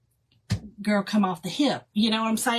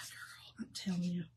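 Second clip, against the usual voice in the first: breathy confiding whisper; 5 semitones lower, 15 dB quieter than usual. Real, not acted. Telling her viewers a secret, in a whisper. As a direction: let them in on a secret, whispered.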